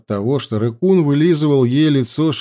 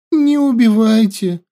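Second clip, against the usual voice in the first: high, tearful begging; sobbing plea; 11 semitones higher, 4 dB louder than usual. A narrator's reading. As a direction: sob out the plea. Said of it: "всхлипывая, завела она". (всхлипывая, умоляюще)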